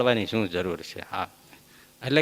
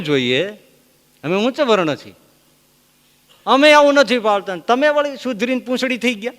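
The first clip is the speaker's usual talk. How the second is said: high, loud reproach; scolding; 9 semitones higher, 6 dB louder than usual. Retold or imitated. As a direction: lecture him loudly, half mocking.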